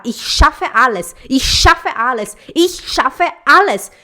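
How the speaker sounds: slow, loud, building mantra; affirmation. Shown as a demonstration. Teacher modelling a confident self-affirmation, loud and slow. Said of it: "Olha pra você e fala".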